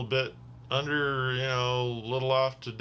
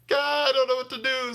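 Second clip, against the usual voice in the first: much higher, louder mock panic; 14 semitones higher, 4 dB louder than usual. Driver panicking as passenger is about to vomit. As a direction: squeal it in helpless alarm. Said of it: "I'm just like".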